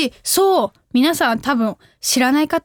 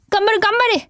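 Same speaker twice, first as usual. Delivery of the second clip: lightly acted shop clerk's cheer; higher-pitched mock cheer; 6 semitones higher than usual. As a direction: cheer the customer on, voice lifted.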